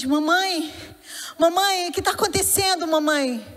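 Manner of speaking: lightly voiced scared little boy; frightened child, imitated. Startled son asking mother what is happening, imitated fright. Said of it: "todo assustado".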